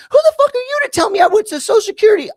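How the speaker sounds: high-pitched, fast, furious mock screech; yelling. Landlord blowing up at him over the phone, mock screaming. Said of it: "Just yelling over the phone".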